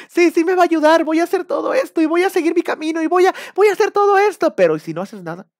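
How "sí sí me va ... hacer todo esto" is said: high, louder hammed-up devotion; mock fervent believer. Believer vowing God will help, mock fervor. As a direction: gush like a true believer.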